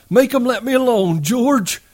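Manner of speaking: high, frightened plea; Lenny begging George, high and terrified. Acted. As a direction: beg for help, panicked. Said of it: "gave a cry of terror".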